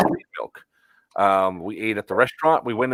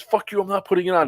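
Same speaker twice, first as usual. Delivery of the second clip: defiant retort; 9 semitones higher, faster than usual. Retold or imitated. Maskless guest defies security again.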